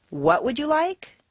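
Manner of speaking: high, incredulous rising question; disbelief. Shown as a demonstration. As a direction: question it as if you can't believe your ears. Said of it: "You can't believe what you just heard".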